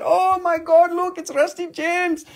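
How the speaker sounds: high, excited fan voice. Retold or imitated. A fan spotting Rusty at the mineral show, high and gushing. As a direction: gush like a starstruck fan. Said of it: "She said".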